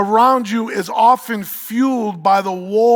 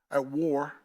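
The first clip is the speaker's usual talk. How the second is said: "war" said in a New York accent, low and soft, with the r dropped; 5 semitones lower, 10 dB quieter than usual. Real, not acted. A preacher's New York accent slipping out mid-verse. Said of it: "my New York accent comes in".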